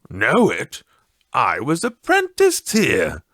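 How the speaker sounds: put-on surprised old man. A narrator's reading. Scrooge answers ghost.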